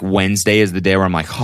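ordinary talk

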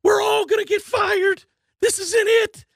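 pressing the owner to step in; mock panic building up; urgent plea. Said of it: "He's trying to tell him".

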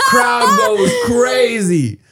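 drawn-out, high laughing yell; real laughter telling how the crowd erupted; laughing shout. Real, not acted. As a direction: let the laugh burst through the words. Wild climax of fight story.

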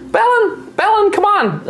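very high call to the back room; young gnome calling. Acted. Young gnome calling her brother from the desk, high call. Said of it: "she kinda calls out and she's like".

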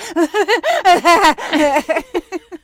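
loud, high cackling laugh; maniacal laugh. Real, not acted. Host recreating her movie-theater breakdown, with a maniacal laugh. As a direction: laugh like you can't stop. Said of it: "it sounds maniacal".

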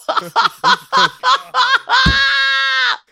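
high, loud screaming laugh; shrieking laugh. Real, not acted. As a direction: burst into a shrieking cackle. Loud laugh at wig joke.

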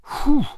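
high-pitched whew of wonder; whistled whew. A narrator's reading. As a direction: let out an astonished, whistly "whew". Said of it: "inward whistle".

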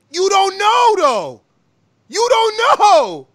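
gloating shout. Real, not acted. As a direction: crow it over him, laughing. Gloating at caller.

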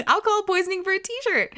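high-pitched, incredulous, laughing outburst; laughing disbelief. Real, not acted. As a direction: exclaim it high through real laughter. Mocks the prize while laughing.

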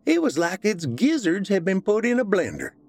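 Missus Warren voice. A narrator's reading. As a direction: play the weary rural mother. Missus Warren tells chicken story.

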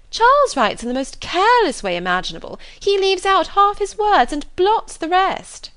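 a simpering high lady's voice; Miss Bingley's gushing voice. Acted. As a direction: flatter him, gushing and showy.

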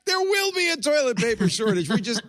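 very high-pitched comic outcry; mock alarm. Host jokes to panel about a coming shortage, mock alarm. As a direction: wail it way up high, mock-panicked.

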